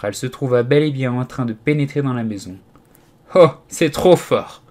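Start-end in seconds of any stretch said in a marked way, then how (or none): 3.4-4.6 high, loud, drawn-out exclamation; exasperated outcry; indignant cry. A narrator's reading. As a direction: cry it out slowly, higher and louder. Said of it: "s'écria-t-elle".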